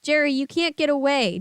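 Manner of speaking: high, loud threat; villain crying out threat; cried. Acted. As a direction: cry out the threat. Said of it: "Doctor Shriek cried".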